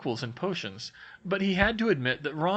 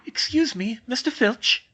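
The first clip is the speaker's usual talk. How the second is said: higher, polite schoolgirl call; higher girl's voice, courteous; polite Hermione; 6 semitones higher, 4 dB louder than usual. Acted. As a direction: politely get his attention.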